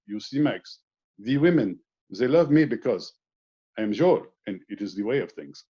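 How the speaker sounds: put-on suave French womanizer's accent; Joel's thick French accent. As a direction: play the self-assured Frenchman, thickly accented. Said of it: "Very thick French accent".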